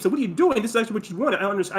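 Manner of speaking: high-pitched acted mother pressing for sense; incredulous mom, voice lifted; baffled mom.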